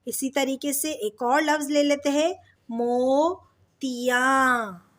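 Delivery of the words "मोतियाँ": मोतियाँ split into mo and ti-yaan; drawn-out syllables. Shown as a demonstration. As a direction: stretch the vowels in मोतियाँ. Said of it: "मोतियाँ में ये का sound".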